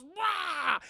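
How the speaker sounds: high loud yell while falling. Retold or imitated. Bruce jumping off the tower, yelling. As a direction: yell as you drop.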